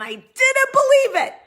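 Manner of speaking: high, loud squawk of disbelief; hammed-up incredulous outburst; exaggerated disbelief. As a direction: shout it way up high.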